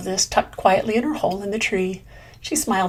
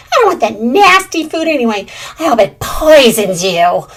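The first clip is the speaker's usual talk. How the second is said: spiteful scream; 3 semitones higher, 8 dB louder than usual. Acted. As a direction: curse him as you storm off. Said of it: "screamed Greedy Squirrel".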